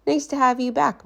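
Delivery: principal. Acted. Principal leaves the class, high and polite. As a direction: bustle off politely. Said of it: "said the principal".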